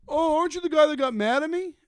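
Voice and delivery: high, loud whiny heckler voice; mock the heckler's accusing whine; mimicking the heckler. Comic retelling the heckler's whiny accusation. Heckler imitated in retold story.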